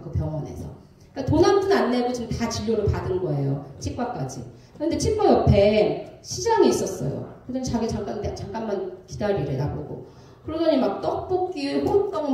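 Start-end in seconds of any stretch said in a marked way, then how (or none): none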